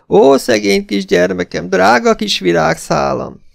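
high-pitched grieving wail; tearful lament. A narrator's reading. Esmeralda lamenting the stolen girl, tearfully wailing. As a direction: mourn the child in a high voice. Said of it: "ismét fékezhetetlen zokogásba tört ki".

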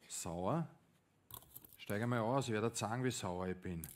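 tief, leise, bedrohlich; drohender Polizist. Acted. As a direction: eine kalte, leise Drohung. Polizist droht dem Fahrer. (Polizist droht leise und tief)

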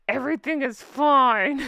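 slow strained cheer, teeth clenched; gritted teeth. Shown as a demonstration. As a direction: push it out through clenched teeth. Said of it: "through gritted teeth".

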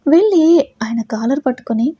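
ordinary delivery

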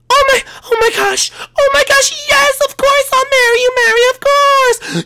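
very high, squealing with joy; thrilled high princess voice. Acted. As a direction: shriek yes like an overjoyed princess. Daisy accepts the proposal.

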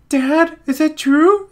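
high gasping betrayed son; gasp, then ask dad high; shocked son. Acted. Son hearing he is not his father's son, high gasping.

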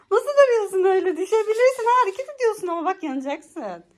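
high playful teasing; amused teasing. Real, not acted. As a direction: tease her wobble. Teasing the wobbling child.

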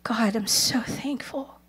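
slow, hushed prayer of thanks; whispered thanksgiving. Retold or imitated. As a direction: imitate an overwhelmed, grateful whisper. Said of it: "this heart of just thanksgiving".

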